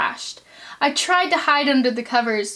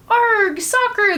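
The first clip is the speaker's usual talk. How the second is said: much higher, louder echo; crew yell; 10 semitones higher, 3 dB louder, slower than usual. Acted. Crew answering the captain on deck, yelling. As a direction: shout it back at the captain. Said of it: "the crew yelled back".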